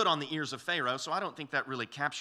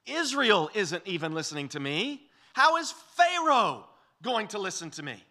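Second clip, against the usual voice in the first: Moses protesting, rising and louder at the end; exasperated complaint; 4 semitones higher, 7 dB louder than usual. Retold or imitated. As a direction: whine it like a cornered man. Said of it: "Moses is saying".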